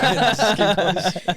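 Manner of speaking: slow, high, giggling mock quote; laughing imitation. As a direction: drag it out through laughter. Imitating hungry coworker, laughing.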